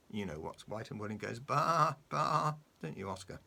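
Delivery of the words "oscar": ordinary delivery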